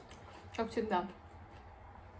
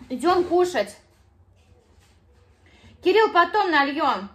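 calling out; 5 semitones higher, 12 dB louder, slower than usual. Real, not acted. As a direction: call him loudly to come eat. Mother calling son to eat. (громко зовёт к столу)

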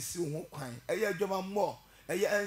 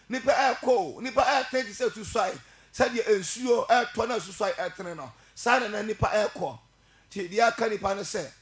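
preaching at full force; 3 semitones higher, 6 dB louder than usual. Real, not acted. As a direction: call the congregation to repent, full voice. Preaching surge on death warning.

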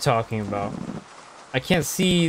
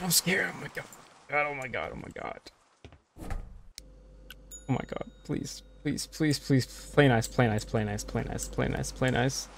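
much softer, partly whispered pleading; scared whispering; 9 dB quieter than usual. Real, not acted. Player hiding from the stalker, frightened whisper. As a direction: beg under your breath not to be found. Hiding from a killer in a horror game.

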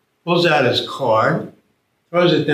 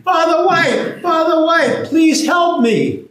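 high, louder desperate call; calling up for help; 4 dB louder than usual. Acted. Tommy in the ditch begging the priest, high loud plea. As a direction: cry out high, pleading. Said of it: "Tommy yells".

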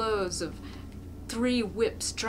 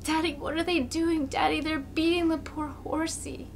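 put-on frightened child; 9 semitones higher than usual. A narrator's reading. Frightened boy asks father.

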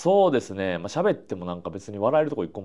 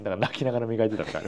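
笑いながら; 3 semitones lower, 5 dB quieter, faster than usual. Real, not acted. Laughing while telling story.

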